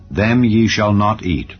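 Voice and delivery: plain reading voice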